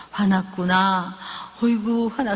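low, slow, gentle sympathy; drawn-out coo over an upset child; soothing empathetic mother. Shown as a demonstration. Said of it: "그렇게 얘기해주면".

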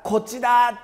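God calling from afar; calling from far. Shown as a demonstration. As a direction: call out as if from far away. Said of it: "神様は遠くから".

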